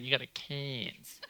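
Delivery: drawn-out Queensland accent on "Cairns". Shown as a demonstration. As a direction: stretch "Cairns" slowly, as a Queensland local would. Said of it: "especially with the accent".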